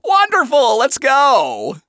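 loud, high showman's cheer; big theatrical enthusiasm; excited interruption. A narrator's reading. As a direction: burst in grandly, ignoring the objection. Lucifer eagerly cuts his daughter off.

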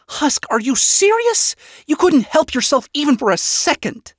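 high-pitched exasperated telling-off; scolding. A narrator's reading. Vaggie to half-conscious bar cat, scolding. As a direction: scold in disbelief. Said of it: "scolded the half-conscious bar cat".

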